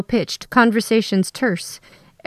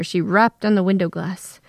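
ordinary delivery

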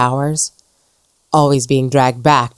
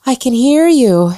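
high, loud gasp of amazement; breathless with shock at being heard; 9 semitones higher, 5 dB louder than usual. Acted. Ghost amazed a dead woman hears her, loud gasp. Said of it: "she gasped".

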